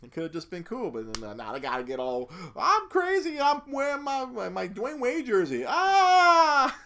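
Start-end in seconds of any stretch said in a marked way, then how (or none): 2.6-5.6 very high, loud caricature; mock crazy character. Critic mocking an unnecessary subplot, high hammed-up voice. 5.7-6.7 loud, high, drawn-out scream; hammed-up crazed yell; mock scream. Critic mocking the film's antics, exaggerated high scream.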